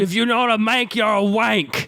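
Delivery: hammy Brit impression; British accent. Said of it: "here's my impression of a British person".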